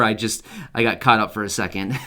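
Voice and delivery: usual speaking voice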